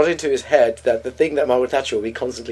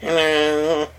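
Princess Anne. Retold or imitated. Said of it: "Princess Anne".

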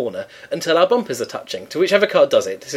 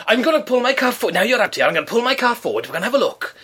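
high indignant mock voice of an older man; pompous neighbour; 5 semitones higher than usual. Neighbour lectures her at the door, high and pompous. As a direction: puff up like an officious ex-copper.

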